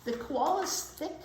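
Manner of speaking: ordinary delivery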